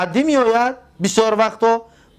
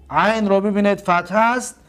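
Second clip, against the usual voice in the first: normal talking voice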